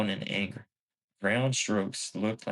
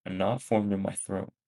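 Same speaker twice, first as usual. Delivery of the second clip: ordinary delivery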